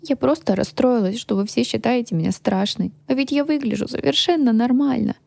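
a touch of hurt tears; tearful. A narrator's reading. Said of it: "всхлипнула я". (обиженно, со слезами в голосе)

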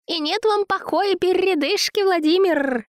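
very high, cooing flattery; babbling, fussing woman. A narrator's reading. Zina soothes angry Vova, high fawning babble. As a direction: coo and fuss to soothe him. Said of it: "лепетала она".

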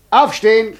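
high, loud shout; sharp loud wake-up shout; shouted command. Acted. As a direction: bark him awake. Devil wakes the student.

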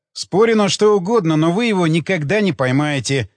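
ordinary delivery, nothing marked

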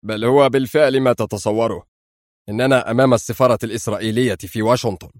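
steady, certain reply; firm. A narrator's reading. Adham answers Hisham, firm and certain. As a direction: settle the question with calm resolve. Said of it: "فقال أدهم في حزم".

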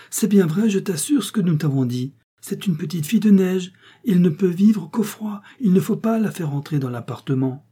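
put-on high, loud child's pleading; pleading cry. A narrator's reading. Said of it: "criait Violette en lui barrant le passage". (Violette supplie son père, aiguë et forte)

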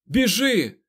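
very high, loud yell. A narrator's reading. Rand grabs the girl's arm, yelling at her to flee. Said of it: "загорлав він на неї".

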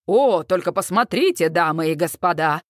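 low-pitched showman's commentary; deep emcee voice; event announcer. A narrator's reading. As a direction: play the rodeo host on the mic. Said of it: "комментирует в микрофон ведущий".

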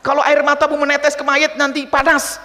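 imitated high-pitched bawling-out; snapping scold. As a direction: mimic someone berating a mourner. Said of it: "lagi duka kok dibentak-bentak".

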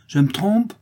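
high, loud mock question; bitter irony. A narrator's reading. Widow answering Clara's accusation of error, bitter irony. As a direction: throw it back with bitter sarcasm. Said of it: "avec une ironie amère".

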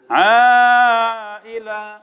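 "عائلا" chanted very slowly with a long held alif; Quran recitation. Shown as a demonstration. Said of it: "عائلا الحكم هنا يا شباب". (عائلا بمد طويل مرتل)

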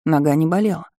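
ordinary delivery

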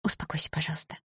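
soft whispered plea; whispering. A narrator's reading. Mother trying to calm her daughter, whispering. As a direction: whisper it gently, almost without voice. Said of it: "прошептала мама".

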